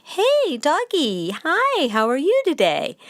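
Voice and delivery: high, sing-song greeting to a dog; nice inviting tone. Shown as a demonstration. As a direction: coax the dog over with a bright friendly hello. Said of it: "in a nice, inviting tone of voice".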